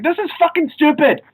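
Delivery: high-pitched mock-exasperated yell; retold exasperated scream. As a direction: re-enact a fed-up outburst without really screaming. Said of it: "I just end up screaming".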